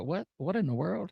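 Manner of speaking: a hint of a puzzled robot voice; Siri confused. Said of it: "She's like".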